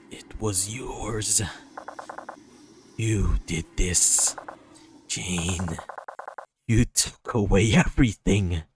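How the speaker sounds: menacing accusation. Acted. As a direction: accuse slowly in a dark, turned voice. Turned character accuses Jane.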